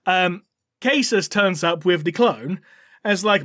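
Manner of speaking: ordinary delivery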